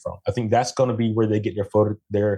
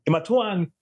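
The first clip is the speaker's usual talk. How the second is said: Jamaican twang; 7 semitones higher than usual. Retold or imitated. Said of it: "Yes, I am twanging".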